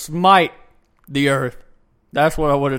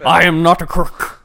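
a hint of a politician's denial, louder; Nixon; 6 dB louder than usual. Comic quoting a disgraced president after his name comes up, lightly mimicked. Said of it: "Like Nixon".

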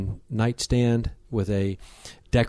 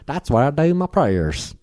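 slow, higher Southern Alabama drawl; Southern Alabama accent; 5 semitones higher, slower than usual. Retold or imitated. Host imitating the homeowner from southern Alabama, Southern accent. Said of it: "born in southern Alabama".

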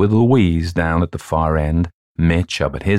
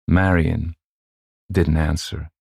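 plain reading voice

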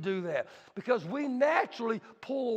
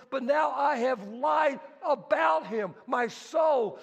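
bitter weeping; 4 semitones higher than usual. Acted. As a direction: cry out in remorse. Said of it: "he wept bitterly".

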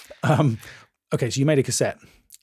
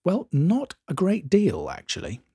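mock-English understatement, slow then fading; exaggeratedly English; 6 semitones higher, slower than usual. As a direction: ham up the English reply, drawn out then dropping away. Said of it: "Which is English for not much".

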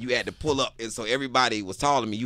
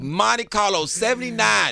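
higher, louder, slow boast; proud shout; 11 semitones higher, 7 dB louder, slower than usual. Real, not acted. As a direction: announce the car proudly. Proudly shouting his old car.

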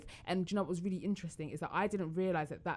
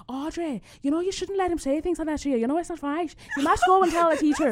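high, loud Irish child imitation; high Irish lilt, urging firmly; Irish girl accent; 10 semitones higher, 8 dB louder than usual. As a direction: insist like an indignant little Irish girl. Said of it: "Such a good accent".

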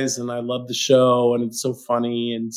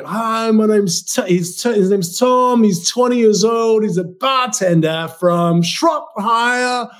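a touch higher, imitating a cheery show announcer; dating show intro voice; 7 semitones higher, slower than usual. Mimicking a reality dating show introducing a contestant, lightly announcer voice. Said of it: "And it's always like".

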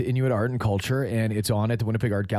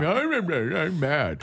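lightly voiced grumpy walrus, raised pitch; a hint of a mad walrus; 4 semitones higher, slower than usual. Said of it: "Is that a walrus voice?".

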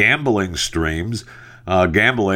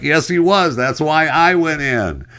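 retold co-host; 7 semitones higher than usual. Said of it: "she was like".

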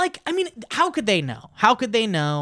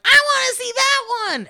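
put-on child voice; 5 dB louder than usual. Kid voiced in story.

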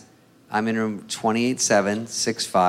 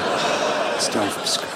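whispered, defeated buddy; deflated whisper; 3 semitones lower, much slower than usual. Retold or imitated. Buddy giving up on the tower name, whispered defeat. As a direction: sigh it out under the breath.

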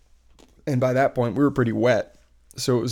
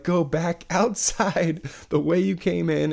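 higher, slow, drawn-out usher instructions; imitated staff directions; 6 semitones higher than usual. Theater staff sending guests back into rain, imitated directions. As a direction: spell it out slowly for the crowd.

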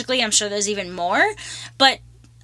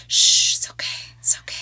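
soothing whisper. Pretend. Wanting to be held and comforted, soothing whisper. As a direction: whisper it like calming a child. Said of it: "and, like".

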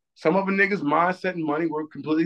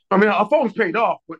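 ordinary talk

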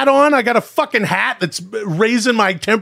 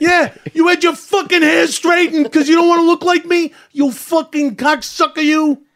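higher, furious rant with cursing at the president; ranting Rappaport impression; 6 semitones higher than usual. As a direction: spit out insults like a hot-headed New Yorker. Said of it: "it sounds like somebody stuffed that movie, uh, Casino".

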